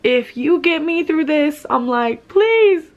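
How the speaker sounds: mock-beg the heavens to get through it; half-acted desperate plea to God; retold desperate prayer. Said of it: "I'm fucking praying".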